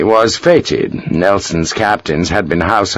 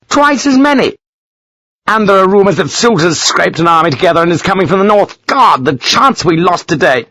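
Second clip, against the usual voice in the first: furious rant; 8 semitones higher than usual. Acted. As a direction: rage at the doubled enemy. Lawford ranting.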